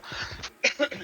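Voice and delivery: short dry cough, breathy. Real, not acted. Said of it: "je tousse". (toux sèche)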